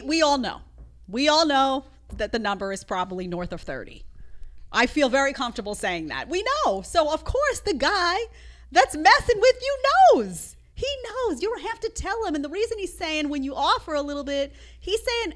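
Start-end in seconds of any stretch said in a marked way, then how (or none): 6.3-12.3 high-pitched, worked-up insistence; emphatic insistence. Real, not acted. Host telling the woman the guy knows, loudly insistent. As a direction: climb higher and louder to the final word.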